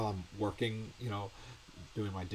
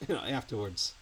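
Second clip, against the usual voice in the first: normal talking voice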